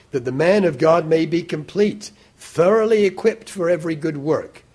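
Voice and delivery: reading it high and loud, with full preaching force; fervent scripture reading. Acted. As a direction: proclaim the verse to the whole hall. Reads scripture with rising force.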